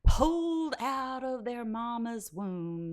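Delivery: high, loud, slow Southern drawl; hammed-up Southern politician, vowels stretched long; mock Southern accent. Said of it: "I'm doing the Southern accent".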